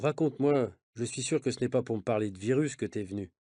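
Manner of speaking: ordinary delivery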